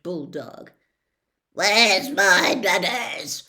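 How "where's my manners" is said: slow, booming, louder bellow; roared. Acted. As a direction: bellow it slowly like a big hearty aunt. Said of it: "roared Aunt Marge".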